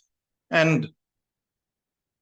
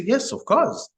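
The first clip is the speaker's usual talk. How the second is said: much higher, eager yes of course; eager villager; 12 semitones higher than usual. Retold or imitated. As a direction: answer brightly up high, glad to help. Villager readily offers mustard seeds.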